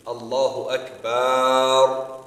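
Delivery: very long, loud "baar" in "Akbar"; slow, sustained A after the b of "Akbar"; elongated final vowel. Shown as a demonstration. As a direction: hold the last A of "Akbar" for ages. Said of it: "they put a long alif after the ba".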